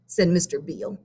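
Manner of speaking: ordinary delivery, nothing marked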